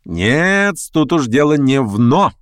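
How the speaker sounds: sharp, authoritative rebuttal; imperious interruption. A narrator's reading. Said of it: "властно и резко перебил Верховенский".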